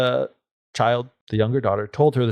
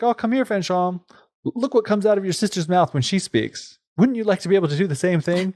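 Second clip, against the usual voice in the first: higher, syrupy coaxing mother; sweet-talking mother; 6 semitones higher than usual. Acted. Mother coaxing her favourite girl, high and sugary. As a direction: lure the daughter with honey. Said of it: "So she's like".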